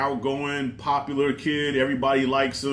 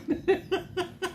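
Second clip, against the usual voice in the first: soft, very high-pitched burst of laughter; high-pitched giggle; 7 dB quieter than usual. Real, not acted. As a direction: squeak out a surprised laugh. Laughs at the surprise twist.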